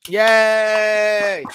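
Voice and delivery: loud, high, long-held yay; celebratory cheer. Real, not acted. Co-host cheers Brandon Moreno getting the award, loud drawn-out cheer. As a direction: cheer it out, stretching the word.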